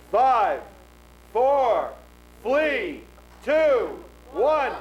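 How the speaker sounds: loud, high, booming countdown; dramatic countdown. Acted. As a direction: count down like a ringmaster. Showman countdown.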